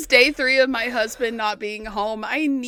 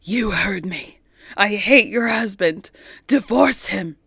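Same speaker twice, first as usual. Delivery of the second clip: spirit through a medium; 7 semitones lower than usual. Acted. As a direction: speak as the spirit from beyond. Spirit insists.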